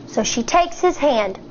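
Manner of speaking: ordinary delivery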